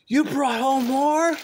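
high, slow, incredulous mom voice; mock mom. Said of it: "Mom's gonna go".

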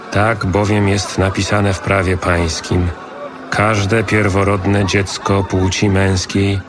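normal talking voice

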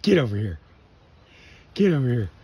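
ordinary talk, nothing marked

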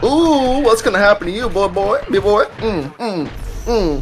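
high-pitched, playful gloating; singling out a victim with high mocking glee; taunting. Real, not acted. Taunting opponents in the fight.

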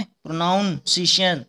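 "प्रोनाउंसिएशन" with a full "noun" in the middle; misread with "noun". Shown as a demonstration. Teacher showing students the misspelling, "प्रोनाउंसिएशन" misread as pro-noun-ciation. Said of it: "इसमें नाउन का कोई मतलब नहीं".

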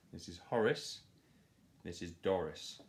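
ordinary delivery, nothing marked

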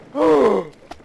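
high, loud gasp; sharp gasp of delight; thrilled gasp. Real, not acted. First dip into the water.

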